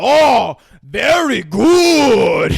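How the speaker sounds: high, very loud, drawn-out approval; hammed-up ecstatic praise; delighted sushi chef. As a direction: gush with long stretched vowels.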